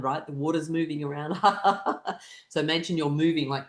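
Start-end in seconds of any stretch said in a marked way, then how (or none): 1.4-2.2 short high ha-ha burst; quick forced laugh; laughter. Shown as a demonstration. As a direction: laugh along with the pretend action. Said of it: "with a sense of fun and laughter".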